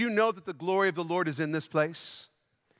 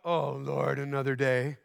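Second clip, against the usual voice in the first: a sagging, lower, reluctant sigh of a prayer; mock weary groan; 8 semitones lower than usual. Preacher mocking a churchgoer dreading another day, weary. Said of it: "You shouldn't get up in the morning and go".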